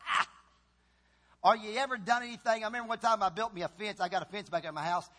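usual speaking voice

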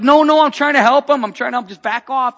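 loud, high, urgent protest; retold shout. Pastor shouting at bystanders who think he's mugging the man, urgent loud protest. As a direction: shout them off, pleading you're helping.

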